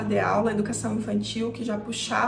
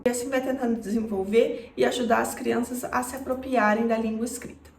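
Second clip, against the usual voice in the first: ordinary talk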